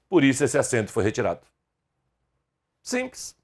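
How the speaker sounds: usual speaking voice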